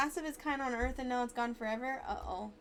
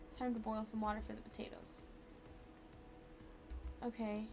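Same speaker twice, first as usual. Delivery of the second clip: ordinary talk, nothing marked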